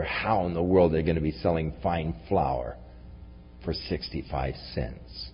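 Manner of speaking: low, quiet scoffing at a promise; mocking disbelief. Skeptic rationalizing how God could sell fine flour, mocking disbelief. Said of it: "and so mocking the promise of God".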